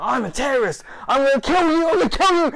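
loud, high-pitched threatening yell; frenzied yelled threats, acted out; imitated shouting. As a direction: shout like a raving madman. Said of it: "He starts shouting at the Taliban".

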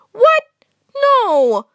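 high, loud shocked yelp. Acted. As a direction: yelp in disbelief. Shocked protest at order.

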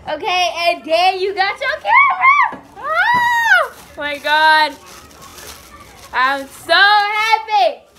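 high, loud and drawn-out with joy; overjoyed shout. Real, not acted. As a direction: cry it out, slow and thrilled. Finally pulls out the camera.

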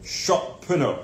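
put-on high, loud British accent; playful, emphatic mock-British accent. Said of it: "Say it in a British accent".

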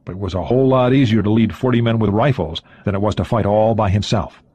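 ordinary delivery, nothing marked

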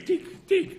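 high sharp tick-tick of falling drops; imitated dripping. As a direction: mimic a water drop ticking. Dripping water torture sound. (חיקוי טפטוף טיפות)

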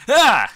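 short high scream of fright; startled scream. Real, not acted. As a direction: yelp as it jumps out. Jump scare hits.

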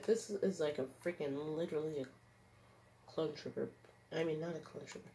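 usual speaking voice

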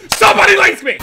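high, very loud plea; exasperated scream. Real, not acted. Gamer begging for an equal opponent, exasperated scream. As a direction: scream it out in frustration. Pleading for fair match, yells.

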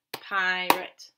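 "Pirate" drawn out in two beats, pie and rit; "Pirate" held on pie, ending in a short rit; syllable by syllable. Shown as a demonstration. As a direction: sound out "Pirate" with both syllables separate. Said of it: "What is our first syllable?".

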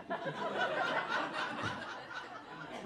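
high unvoiced cackle. After the high cry.

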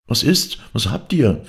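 high, eager maids' questions; anxious calling out. A narrator's reading. Maids call to those returning pale from town, high urgent questions. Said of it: "riefen die anderen Dirnen".

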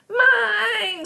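put-on very high, drawn-out toddler screech. A narrator's reading. Toddler screaming over her cookie, screeched. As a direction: screech it like a furious small child. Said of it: "screeched Boots".

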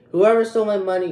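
loud, quick demand; angry call-out. Real, not acted. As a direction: fire it at the thief. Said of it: "I should not be acting like this".

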